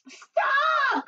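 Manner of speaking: very high, drawn-out shout; crying out. A narrator's reading. Giant's wife halting the bean fight, crying out. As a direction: shriek it to halt the mess. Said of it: "cried Missus Giant".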